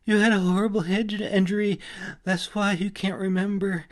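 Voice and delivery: fairy pleading while her wrist is twisted; pleading in pain. A narrator's reading. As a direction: beg him to believe you, high and loud.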